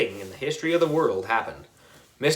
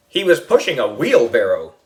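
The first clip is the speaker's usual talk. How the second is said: usual speaking voice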